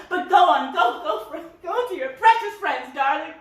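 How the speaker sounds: high, loud, sarcastic send-off; bitter dismissal. Acted. Mother sending son off to precious friends, bitter. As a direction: dismiss him in a strident, wounded huff.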